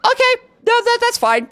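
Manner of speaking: very high startled squeak; panicked squeak. Real, not acted. Surprised by Latios encounter.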